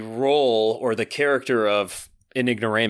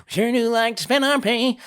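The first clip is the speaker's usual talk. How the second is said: high nasal song imitation; high, nose-pinched mock vocal; 11 semitones higher, 3 dB louder than usual. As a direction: push it way up into your nose. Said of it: "He's way up there in his nose".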